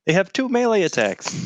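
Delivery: high-pitched objection; real exasperated table-top protest; indignant protest. Real, not acted. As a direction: argue back at the game master, voice shooting up. Player protesting the game master's ruling.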